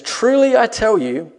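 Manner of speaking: slow, loud, high pronouncement; solemn proclamation. Acted. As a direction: declare it slowly like a prophet. Quoting Jesus' famous words.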